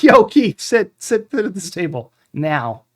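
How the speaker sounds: a hammed-up urgent call; shouted call. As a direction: yell at the friend who just walked in. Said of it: "I'm like".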